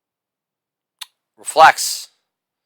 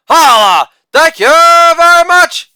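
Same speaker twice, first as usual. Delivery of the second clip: loud "Holla", then a very high, loud "thank you very much"; hammed-up falsetto victory call; catchphrase shout; 11 semitones higher, 5 dB louder than usual. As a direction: belt out the catchphrase like a hype man.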